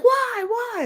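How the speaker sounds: high whining why; whiny daughter. Retold or imitated. Daughter begging her mom, high and whiny. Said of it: "And she's like".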